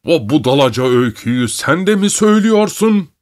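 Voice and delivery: high, indignant outburst; angry shout. A narrator's reading. Uncle rages at the child's accusation, shouting. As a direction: raise the pitch into an angry question. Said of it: "diye bağırdı".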